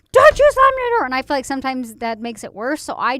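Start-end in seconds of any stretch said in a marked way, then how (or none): 0.1-1.0 sharp high shout at a slammed door; furious parent shout; yelling at daughter. Retold or imitated. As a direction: bark it up the stairs like an angry dad. Mom quoting husband yelling at daughter.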